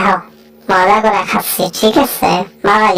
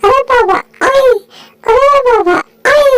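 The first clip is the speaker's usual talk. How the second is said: high cartoon animal voice, whiny and hungry; high, fussy critter voice; squeaky pet voice. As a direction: speak as the greedy little pet begging for food.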